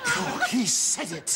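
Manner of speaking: normal talking voice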